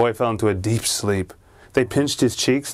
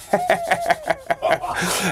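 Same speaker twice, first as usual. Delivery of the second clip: high, twisted possessed giggle; evil giggle; 7 semitones higher than usual. Acted. The possessed boy grinning at his father with an evil giggle. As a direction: let out a creepy evil laugh. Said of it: "evil, twisted look... kinda like this".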